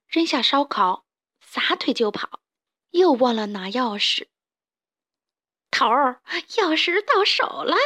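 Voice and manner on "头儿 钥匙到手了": high-pitched excited henchman; piping little sidekick; shrill, squeaky voice. Acted. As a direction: squeal the good news to the boss. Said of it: "田鼠细尾巴尖声尖气地说".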